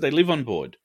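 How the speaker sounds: normal talking voice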